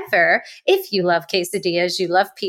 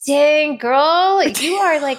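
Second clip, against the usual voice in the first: excited; 7 semitones higher, 4 dB louder, much slower than usual. Real, not acted. Host cheering guest who looks young, loud and high. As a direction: belt it out in delight. Reacts to guest's youthful age.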